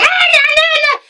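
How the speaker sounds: very high mock-diva protest; acting like a queen. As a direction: squeal it like a drama queen. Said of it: "acting like a queen".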